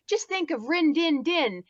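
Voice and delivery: rally a scared friend in a lifted voice; lightly acted coaxing; encouraging Freddy. Freddy urging Scooby on, acted.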